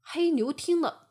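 ordinary delivery

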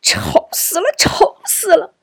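put-on high, strained cry of a child in distress; pained shouting. A narrator's reading. Boy shouts from his room at the music, high pained yell. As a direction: cry out sharply, clutching at your head. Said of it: "双手捂着头嚷道".

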